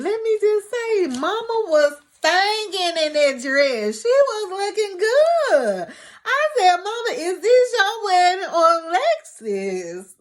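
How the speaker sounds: high-pitched, hyped-up raving; excited gushing. Real, not acted. Gushing over Mama's dress, hyped.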